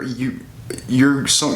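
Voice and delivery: normal talking voice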